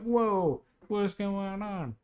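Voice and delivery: high, slow, drawn-out whoa of surprise; high slow amazed what's going on; bewildered whoa. Retold or imitated. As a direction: stretch it out, amazed and lost. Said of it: "I'm like".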